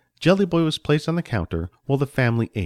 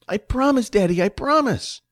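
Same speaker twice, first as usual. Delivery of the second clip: put-on high, pleading child voice; eager little boy; 11 semitones higher than usual. Johnny promises his father, eager high child voice.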